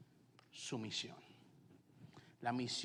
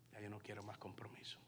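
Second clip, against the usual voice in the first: whispered thought; 5 semitones lower, 13 dB quieter than usual. Retold or imitated. Inner reluctant answer to God.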